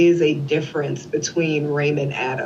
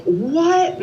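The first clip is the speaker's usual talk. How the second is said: high-pitched, mildly mimicked shriek; retold shocked scream; 12 semitones higher than usual. Sister hearing of the jury foreman's letter, retold shock. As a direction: cry out in mock shock, pitched way up. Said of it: "I'm s-, screaming".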